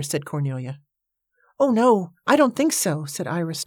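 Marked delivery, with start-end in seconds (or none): 1.6-3.0 high-pitched, anxious objection; alarmed. A narrator's reading. Iris turns pale at raising the offer, alarmed. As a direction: back away from the idea in alarm. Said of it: "turning pale".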